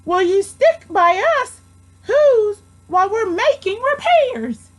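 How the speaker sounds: very high, small pleading voice; tiny mayor pleading with Horton. A narrator's reading. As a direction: squeak it up from the speck. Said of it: "pleaded the voice of the mayor".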